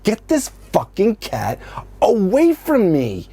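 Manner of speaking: high, slow furious outburst; angry yell at the cat. Retold or imitated. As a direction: bellow at the cat to get off. Yelling at the cat to leave.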